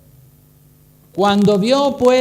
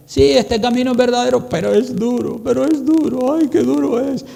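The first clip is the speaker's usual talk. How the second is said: high, plaintive whine on "duro"; whining complainer; 6 semitones higher than usual. Retold or imitated. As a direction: moan like someone giving up. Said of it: "dicen la misma frase, pero invertida". (quejido agudo y lastimero)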